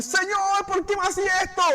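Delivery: queja aguda y lastimera; ladrón quejándose. Retold or imitated. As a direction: imitando un lamento exagerado. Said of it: "Y él empezó a decir". (imitando al ladrón que se queja, agudo)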